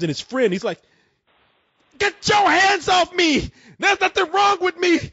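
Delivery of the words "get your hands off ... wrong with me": Tucker going crazy. Retold or imitated. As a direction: act out a man losing his mind. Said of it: "he continues to go crazy".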